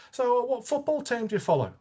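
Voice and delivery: high, put-on English accent. Salesman baiting the flirting customer in an English accent. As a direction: lay on a mock English accent to bait him. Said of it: "I put on the English accent a little bit".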